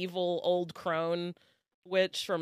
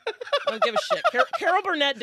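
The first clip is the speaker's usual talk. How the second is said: loud outburst; 14 semitones higher, 9 dB louder, faster than usual. Real, not acted. Exasperated outburst.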